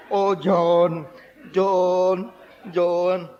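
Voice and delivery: loud, long-held lament of the name; hammed-up keening, each name stretched out; mock wailing. Widow praying loudly at the grave, mock wailing. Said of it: "dia sembahyang lagi".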